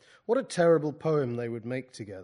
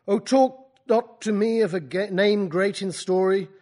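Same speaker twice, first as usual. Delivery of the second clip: grand recital; 6 semitones higher, 9 dB louder than usual. Acted. Byron line in a poetry reading.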